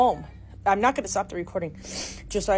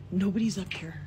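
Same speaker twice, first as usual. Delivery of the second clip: ordinary delivery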